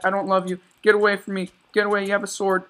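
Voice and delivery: high, loud panicked pleas; frantic yelling. Real, not acted. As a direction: yell to make them back off. Yelling at enemies chasing him.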